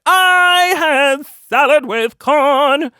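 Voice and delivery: high, slow, hammed-up declaration; proud announcement. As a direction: draw it out triumphantly. Proudly reports salad, acted.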